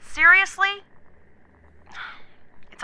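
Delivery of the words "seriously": exasperated. Acted. Officer asked to repeat report.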